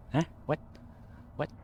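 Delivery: put-on curt Boston-accented questions; short punchy mock Bostonian. Imitating Ray Donovan for laughs, curt Boston accent. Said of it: "they had the Boston accent thing".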